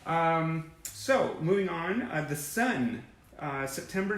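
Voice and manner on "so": usual speaking voice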